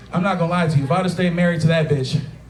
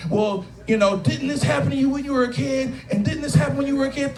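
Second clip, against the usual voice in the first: higher, needling ex-wife voice; mock nagging ex-wife; 6 semitones higher than usual. Comedian mimics his ex-wife's needling question. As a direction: pester him about his childhood.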